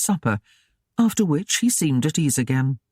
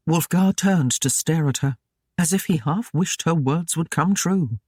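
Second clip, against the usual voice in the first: ordinary delivery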